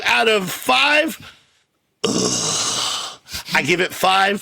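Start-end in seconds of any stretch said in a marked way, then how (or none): 2.0-3.2 much lower gruff ugh; lightly imitated gravel grunt; Eastwood grunt. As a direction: growl like Clint before the verdict. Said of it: "Eastwood grunt again".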